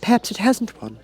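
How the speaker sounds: put-on high, tentative girl's voice; timid Alice. A narrator's reading. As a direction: venture it shyly. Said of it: "Alice ventured to remark".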